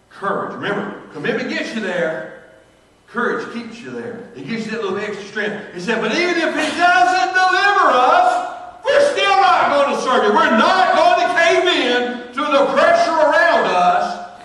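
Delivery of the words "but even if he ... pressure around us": much higher, loud and slow; defiant proclamation. Acted. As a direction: declare it in full defiance. Preacher voicing defiant martyrs.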